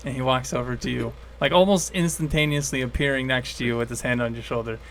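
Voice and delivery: hushed game master narration; story narration. A narrator's reading. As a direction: set the scene quietly.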